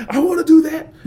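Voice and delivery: put-on excited kid. Kids after his talk, excited to do forestry. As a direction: burst out like a thrilled kid. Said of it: "when I talk to kids".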